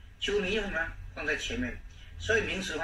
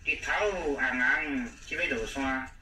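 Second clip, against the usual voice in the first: slow Taiwanese example; slower than usual. Shown as a demonstration. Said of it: "那台语什么".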